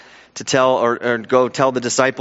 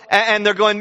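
normal talking voice

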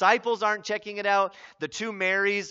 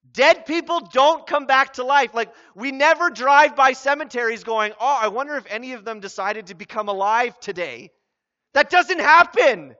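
much louder and higher impassioned sermon voice; fiery preaching; 7 semitones higher, 11 dB louder than usual. Acted. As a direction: preach it with full conviction to wake them. Preaching at full force.

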